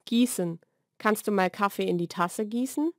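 ordinary talk, nothing marked

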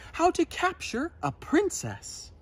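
high teacher voice; Madam Dragon teaching. A narrator's reading. Madam Dragon telling pupils the lesson, high. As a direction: announce the lesson. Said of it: "said Madam Princess".

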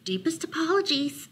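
high little mouse voice; apologetic mouse. A narrator's reading. Mouse apologizing to muttering Bear, high-voiced.

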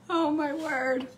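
usual speaking voice

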